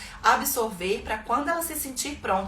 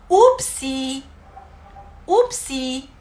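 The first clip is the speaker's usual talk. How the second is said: a louder, sing-song upsi; playful oopsie; 3 semitones higher, 8 dB louder, much slower than usual. Shown as a demonstration. Parent to child who dropped something, playful oopsie. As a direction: say oops to a toddler. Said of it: "A gente fala".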